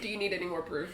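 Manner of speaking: deflated low mutter of the line. Acted. Player repeating the taunt on request, softly. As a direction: say it again but drained and quiet. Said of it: "say it again".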